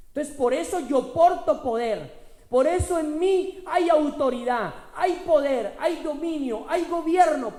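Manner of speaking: loud, high, building preacher's cry; preaching at full force. Acted. Pastor proclaiming to the congregation, loud and rising. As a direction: proclaim the power within, rising.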